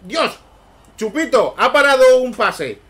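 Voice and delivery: high, loud amazed outburst; surprised. Real, not acted. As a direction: burst out in disbelief. Surprised reaction to save.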